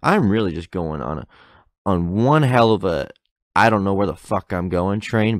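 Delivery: ordinary delivery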